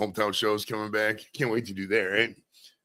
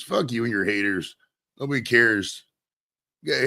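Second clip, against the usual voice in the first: normal talking voice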